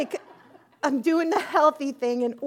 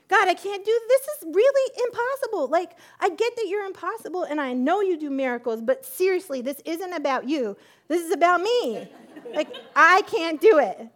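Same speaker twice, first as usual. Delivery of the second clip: high, loud insistent pleading; loud protest; 6 semitones higher, 6 dB louder than usual. Retold or imitated. Woman arguing with God that fasting is impossible, insistent. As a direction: dig in and argue back hard.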